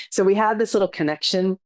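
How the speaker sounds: ordinary delivery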